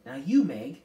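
loud, firm prompt; ordering. A narrator's reading. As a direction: order the girl to take a turn. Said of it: "Miss Whatsit ordered".

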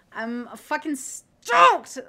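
slow, shouted last word in pure glee; real uncontained excitement; bursting with excitement. Real, not acted. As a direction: build to a loud, high, drawn-out STOKED. Cannot hide excitement about news.

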